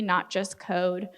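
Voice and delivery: ordinary talk, nothing marked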